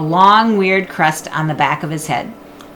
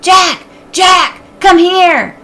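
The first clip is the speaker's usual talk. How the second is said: calling out; 12 semitones higher, 8 dB louder, much slower than usual. Acted. Annie shouting from woods.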